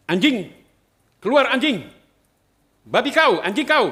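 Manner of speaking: loud, high, furious cursing at the door; retold angry shouting. Attackers pounding on the priest's car, shouting curses in fury. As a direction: bellow the insults like a mob outside. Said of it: "Saya digedor".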